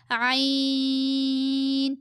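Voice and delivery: "عین" squeezed from the throat, held long. Shown as a demonstration. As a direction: constrict the throat and stretch "عین" on one tone. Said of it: "حلق سے نکلے گی اس کی آواز".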